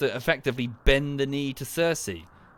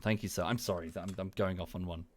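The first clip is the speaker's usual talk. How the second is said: ordinary delivery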